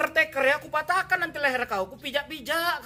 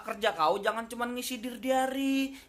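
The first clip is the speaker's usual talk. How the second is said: normal talking voice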